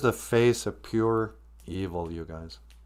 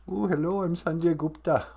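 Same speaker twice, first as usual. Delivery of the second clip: high, mocking doctor impression; Sanjay Gupta; 5 semitones higher, 3 dB quieter than usual. Host poking fun at a TV doctor, mock impression. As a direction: put on a higher, cooing TV-doctor greeting. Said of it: "if I was Sanjay Gupta".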